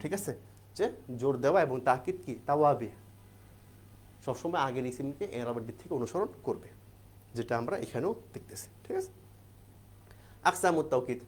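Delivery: ordinary talk, nothing marked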